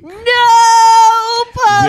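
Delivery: high, loud wail of protest; hammed-up high anguished no; mock anguished cry. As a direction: cry out for the puppy in mock horror. Reacting to dog killed.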